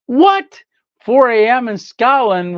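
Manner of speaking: startled disbelief; taken aback to hear it is four in the morning; surprised. Real, not acted. Hearing a viewer is up at 4am.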